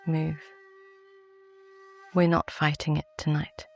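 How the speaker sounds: ordinary talk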